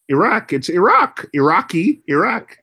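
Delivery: Iraq with a short "ih" start, ih-ROCK; lifted, careful "ih-rack, ih-rock" for Iraq. Shown as a demonstration. Speaker testing aloud how they say it, short-I Iraq. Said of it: "I don't pronounce the I at all".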